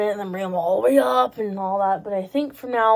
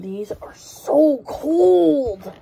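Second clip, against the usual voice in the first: high, slow, laughing cry of so cold; exclaiming at the cold; 5 semitones higher, 5 dB louder, much slower than usual. Real, not acted. Vlogger touching icy bowling balls, laughing exclaim. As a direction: drag out cold with a laughing gasp.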